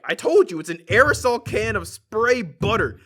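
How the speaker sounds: loud, drawn-out mock insistence; hammed-up fed-up explaining; imitated impatient anger. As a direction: spell it out crossly as the old customer. Customer loses patience explaining.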